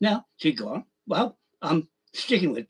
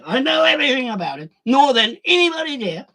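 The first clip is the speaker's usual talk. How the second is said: emphatic shout; 7 semitones higher, 5 dB louder than usual. Real, not acted. Ranting at the fellas who kicked him.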